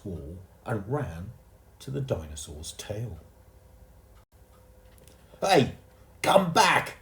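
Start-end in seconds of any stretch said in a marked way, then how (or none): 5.4-7.0 high, loud angry call; angry shout. A narrator's reading. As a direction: call the boy back furiously. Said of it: "shouted an angry voice".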